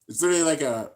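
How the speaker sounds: usual speaking voice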